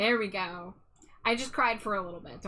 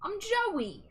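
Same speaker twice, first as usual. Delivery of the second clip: much higher, slow New York accent, hammed up; 11 semitones higher, slower than usual. Streamer reading a chat request as Joey, in a hammy New York accent. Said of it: "Read this in a New York accent".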